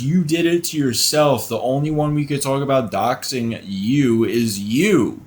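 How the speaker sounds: high, loud mocking voice, slow and laughing; mock her words back at her in a high, sneering voice; mocking mimic. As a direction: parrot her back, shrill with scorn and laughter. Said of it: "we started off with".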